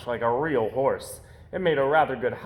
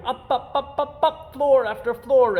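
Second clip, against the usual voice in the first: rising higher and higher; 7 semitones higher, 5 dB louder than usual. A narrator's reading. Building climbs higher and higher.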